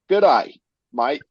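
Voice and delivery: Aussie sign-off landing at last; broad put-on Australian farewell; Australian accent. Host signing off the listener's review, Australian accent arriving. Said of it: "it kinda came in at the end there".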